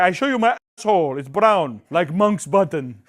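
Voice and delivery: loud, fast mock French accent; hammed-up blunt stripper voice; mock French girl. Imitating the French contestant stripping, loud and hammed-up. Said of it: "She's like".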